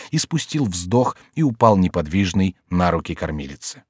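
plain reading voice

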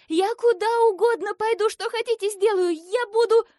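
put-on high, loud, breathless child's promises; hurried eagerness. A narrator's reading. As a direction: tumble over the promises, desperate to agree. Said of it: "заторопилась Ася".

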